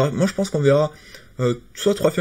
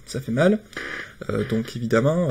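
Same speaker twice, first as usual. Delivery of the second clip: ordinary talk, nothing marked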